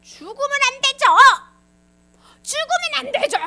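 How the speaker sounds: high, wailing mock objection; hammed-up outraged Peter; angry protest. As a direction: protest hotly, refusing to accept it. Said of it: "막 그러면서 화를 냈어".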